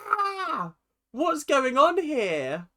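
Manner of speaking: high, slow wail of bewilderment; mock distress. Voicing his thoughts as mister popular, mock distress. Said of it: "He's like".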